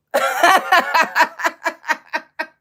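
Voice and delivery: loud, high, long cackle; big rolling belly laugh; cracking up. Real, not acted. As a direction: crack up at your own joke. Cracks up at own line.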